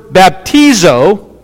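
BAPTIZO as "bap-TEE-zoh", slowly; Greek pronunciation. Shown as a demonstration. Preacher teaching the Greek word, slow "baptizo" as bap-TEE-zoh. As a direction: stress a long TEE in "baptizo". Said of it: "that's how you say it".

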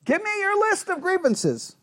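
high-pitched dare to accuse; challenging. Acted. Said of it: "or is he saying".

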